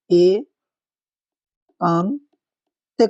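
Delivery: very slow एकांतिक, drawn out in separate pieces; syllable-by-syllable demonstration. Shown as a demonstration. Teacher showing the correct spelling, very slow and syllable by syllable. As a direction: stretch एकांतिक apart, pausing between syllables. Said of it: "ये होगी".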